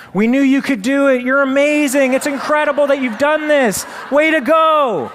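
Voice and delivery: very high, loud cheering. Retold or imitated. Crowd cheering the tiny frog, very high and loud. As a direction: celebrate him with loud cheers. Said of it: "they start cheering".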